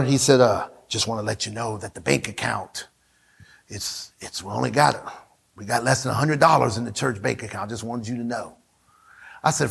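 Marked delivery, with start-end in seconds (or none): 0.4-8.6 very low, soft, mumbled report; Frank's voice. Retold or imitated. Preacher imitating the accountant reporting the empty bank account, very low. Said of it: "that's how he talks".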